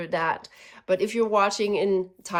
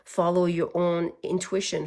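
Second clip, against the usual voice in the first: ordinary delivery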